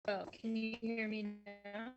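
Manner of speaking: normal talking voice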